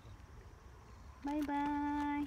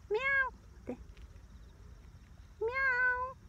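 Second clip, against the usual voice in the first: cat meow; 11 semitones higher than usual. Pretend. Meowing at the cat.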